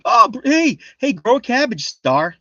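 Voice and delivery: high, loud, excited call; eager mock pitch. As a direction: pitch it up like a bright idea for a friend. Acts out own past eager thought.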